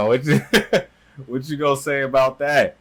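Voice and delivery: loud, breaking into a laugh; amused challenge. Real, not acted. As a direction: crow it through laughter. Gleeful reaction to the blunt news.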